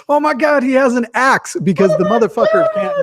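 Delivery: high, panicked mock cry. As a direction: squeal it like a scared onlooker. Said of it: "we're gonna hear".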